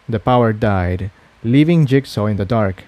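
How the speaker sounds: usual speaking voice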